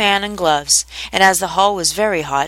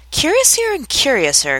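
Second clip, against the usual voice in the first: high-pitched astonished exclamation; surprised cry; 7 semitones higher than usual. A narrator's reading. Alice amazed at her own growing body, surprised cry. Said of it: "cried Alice".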